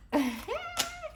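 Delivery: sudden high squealing laugh; high cackle. Real, not acted. Reader cracking up at her own joke, high laugh.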